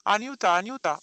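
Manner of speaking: loud, bright calling out; cheerfully calling the maid; joyful call. A narrator's reading. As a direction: call her gladly up the stairs. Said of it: "exclamă voios".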